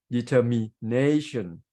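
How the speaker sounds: "determination" broken before a strong NAY; de-ter-mi, then NAY-tion; stress on NA. Shown as a demonstration. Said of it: "the stress shift to the word nay".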